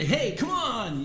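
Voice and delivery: lightly acted, lifted-pitch call; a hint of a game character calling out; Jackie. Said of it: "Jackie's kinda like the".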